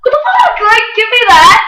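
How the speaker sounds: high, loud indignant demand; high-pitched outraged grab for the guitar pick; indignant outburst. Retold or imitated. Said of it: "we got into a huge argument".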